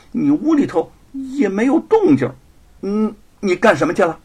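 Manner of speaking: loud, high, needling accusation with scornful hums; mocking half-smile. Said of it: "似笑非笑地说". (似笑非笑地质问)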